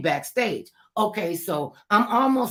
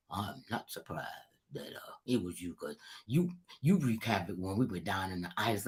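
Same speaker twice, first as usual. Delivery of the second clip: [name] impression; 10 semitones lower, 9 dB quieter than usual. Storyteller imitating [name] teasing her backstage, deep and low. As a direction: drop way down into a smooth bass [name] voice. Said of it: "do my best [name] voice".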